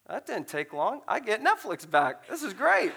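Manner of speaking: building to a high, loud cheer; mock excitement. Retold gleeful thought of getting Netflix back.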